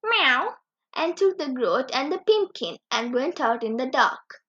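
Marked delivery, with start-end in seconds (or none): 0.0-0.6 cat meow. Acted. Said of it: "Simpkin said again".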